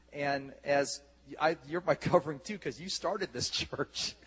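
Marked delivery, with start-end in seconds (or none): none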